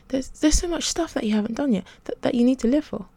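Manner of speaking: insist, tripping over the first words; insistent. A narrator's reading. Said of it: "he insisted".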